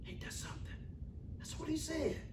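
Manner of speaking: whispered wonder; soft whispered marvel; whispered aside. Real, not acted. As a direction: breathe it to the church. Preacher's hushed aside.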